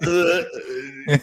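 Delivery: imitating a stunned, stuck stammer; frozen stammer. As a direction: act out being struck speechless. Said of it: "I froze".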